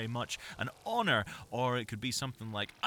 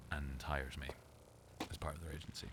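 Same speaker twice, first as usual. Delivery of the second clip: whisper; 6 semitones lower, 9 dB quieter than usual. Real, not acted. Hoping an agency hires him.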